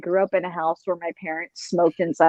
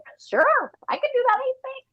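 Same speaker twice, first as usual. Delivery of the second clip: very high, doubtful little voice; mock-nervous high-pitched self-doubt; nervous squeak. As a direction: agree timidly in a tiny squeak. Acting out nervous first-session agreement.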